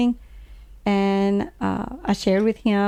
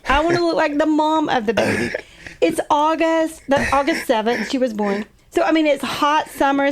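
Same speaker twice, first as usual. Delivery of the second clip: ordinary talk, nothing marked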